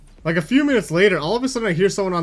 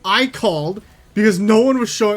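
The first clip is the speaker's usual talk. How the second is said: higher, forceful mock yell; retold angry outburst, pitched up; imitating yelling cashier; 6 semitones higher, 3 dB louder than usual. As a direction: snap back like an irate head cashier. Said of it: "starts yelling at me".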